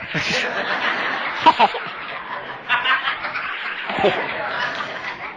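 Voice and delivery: long soft crowd laughter; the room chuckling along; audience laughter. Real, not acted. Audience laughing at the speaker's confession.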